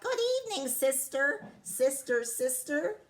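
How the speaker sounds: the duck calling to her sister; quacking call. Acted. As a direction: call sweetly up high. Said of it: "She quacked".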